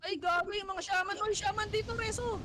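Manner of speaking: high excited calls to the team; rally the team, voice way up; excited shot-calling. Real, not acted. Hyped shot-calling in a teamfight.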